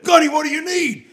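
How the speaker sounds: high, loud eager offer to help; loud retold hearty shout. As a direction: shout it like a helpful officer.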